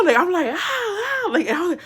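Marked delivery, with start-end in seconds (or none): 0.5-1.3 high frightened yelps; repeated screams. Retold or imitated. Startled woman still screaming at the aunt. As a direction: keep screaming in shock. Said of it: "I couldn't stop screaming".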